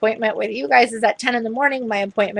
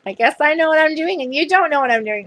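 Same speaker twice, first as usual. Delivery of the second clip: playful retold teasing, pitched up; 6 semitones higher, faster than usual. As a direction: tease her lightly in a higher voice. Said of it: "I kinda teased her".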